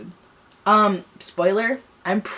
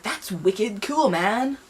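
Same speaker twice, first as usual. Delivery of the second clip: mock cool dude; 3 semitones higher, much slower than usual. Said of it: "was like".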